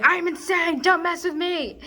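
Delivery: high, loud unhinged scream. Acted. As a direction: yell it like a crazed threat.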